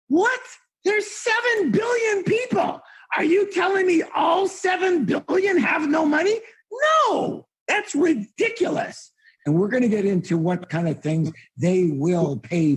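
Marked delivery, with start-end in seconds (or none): 0.1-9.1 much higher, shouting in disbelief; incredulous shout. Real, not acted. Speaker to audience, mocking the objection, incredulous shout. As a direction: blast the objection as ridiculous.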